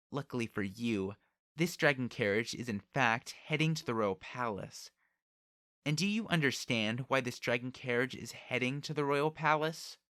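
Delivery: imperious, rising to high and loud; haughty noblewoman demanding an answer; proud, menacing girl. Acted. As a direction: pronounce judgment from above with a threat. Proud girl threatens and tests Subaru.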